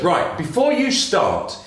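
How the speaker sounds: hammed-up barman warning the rabbit; threatening barman.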